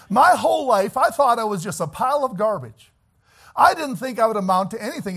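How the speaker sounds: fervent pulpit testimony, loud and high; full-force preaching. Acted. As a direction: preach it out to the congregation with fire.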